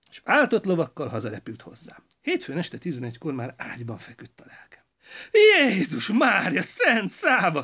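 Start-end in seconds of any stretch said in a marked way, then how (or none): 5.3-7.6 high, loud, slow cry of horror; wife crying out in horror, high and loud; shocked outcry. A narrator's reading. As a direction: shriek it out so the market hears. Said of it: "kiáltotta most olyan hangon".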